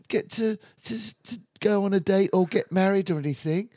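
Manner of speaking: high, hesitant put-on girly voice; mocking reality contestant. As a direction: lift the voice high and dither like a dim contestant. Mocking a female reality-show contestant.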